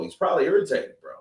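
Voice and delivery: usual speaking voice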